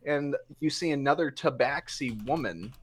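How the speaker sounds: ordinary talk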